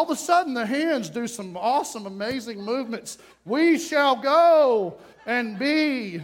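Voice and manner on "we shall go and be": stilted stage acting. Civic theater amateur declaiming on stage, stilted. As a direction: declaim it very slowly like a hammy amateur. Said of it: "Nobody talks like that".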